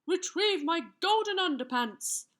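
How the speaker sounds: high, loud plea from a trembling king. A narrator's reading. Trembling king asks for his underpants, pleading. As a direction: plead high and urgent, a touch fearful. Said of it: "the trembling king replied".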